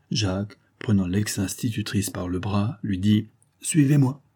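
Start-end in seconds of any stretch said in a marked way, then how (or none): 3.6-4.2 raised, controlled order; brief command. A narrator's reading. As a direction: tell the prisoner to come along, firmly. First order to the prisoner.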